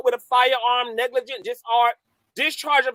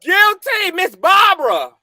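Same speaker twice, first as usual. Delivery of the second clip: gloating taunt; 5 semitones higher than usual. Real, not acted. Calling out a critic.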